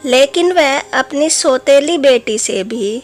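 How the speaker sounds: ordinary delivery, nothing marked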